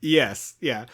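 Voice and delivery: ordinary delivery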